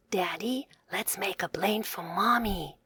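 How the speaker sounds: whispered secret to dad; quiet whisper. A narrator's reading. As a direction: lean in and whisper it. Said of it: "he asked quietly".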